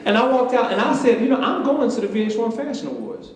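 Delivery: high, loud proud declaration; triumphant announcement. Retold or imitated. Storyteller retells striding out to the fashion awards, triumphant.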